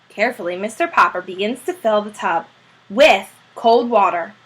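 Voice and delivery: ordinary delivery, nothing marked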